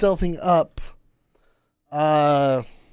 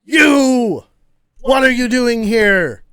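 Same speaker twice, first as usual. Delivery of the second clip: loud, high challenge to intruders; angry orc shout; 7 semitones higher, 8 dB louder than usual. Acted. Orc turns on the intruders, angry shout. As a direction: bellow it as a startled orc guard. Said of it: "the orc turns to y'all and goes".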